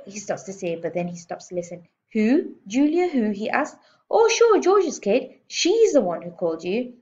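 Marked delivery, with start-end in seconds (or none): 2.1-6.9 much higher, louder eager phone voice; suddenly friendly. A narrator's reading. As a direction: switch to salesman charm. Manager's mood flips on phone.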